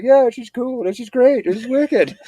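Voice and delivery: high, chill cat voice; voicing the cat. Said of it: "It's like".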